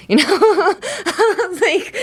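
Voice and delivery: laughing through the words, high and loud; giggling at her own quip. Real, not acted. Laughing at her own joke to the viewers.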